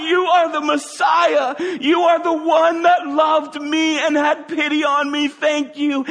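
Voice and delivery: high, loud cry of joyful gratitude; ecstatic shouted thanks. Retold or imitated. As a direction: shout your thanks to Jesus as you run. Healed man shouting thanks.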